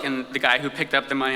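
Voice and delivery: ordinary talk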